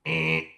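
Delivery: low, held buzzer noise; wrong-answer buzzer. Acted. Coach rejecting the victim mentality, imitated low buzzer. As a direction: buzz it like a game-show wrong answer.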